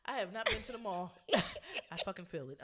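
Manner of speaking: tipsy, laughing a little; slightly tipsy and giggly. Real, not acted. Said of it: "I am a little tipsy".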